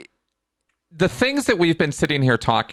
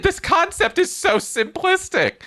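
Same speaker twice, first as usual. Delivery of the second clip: voice gets high; 9 semitones higher than usual. Real, not acted. Ranting to the guest about conspiracy theorists, getting higher. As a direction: pitch climbing higher with exasperation. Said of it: "my voice gets high".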